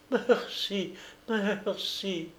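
high, soft, choked thanks; sobbing with joy, barely able to speak; stammered through tears. Acted. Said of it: "Des sanglots de joie l'étranglaient ... balbutier". (merci balbutié, étranglé de sanglots de joie)

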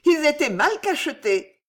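high, insistent excuse; defensive old woman. A narrator's reading. Old woman excuses the opened letters, defensive and high. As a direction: insist on a weak excuse, pitch up.

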